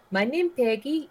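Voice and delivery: high-pitched mock call-centre operator with rising end; Peggy. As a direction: imitate a comic commercial's foreign operator. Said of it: "my favorite call center commercials".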